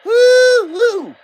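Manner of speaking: high, loud hammed-up woo-hoo; mock whoop. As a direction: whoop like a rowdy good old boy.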